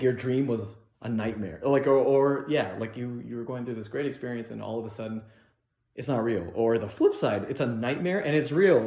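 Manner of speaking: normal talking voice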